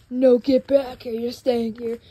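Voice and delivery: loud commanding yell; shouted order to stop the kids; stern shout. Acted. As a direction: bark them back into place. Stopping the kids from leaving.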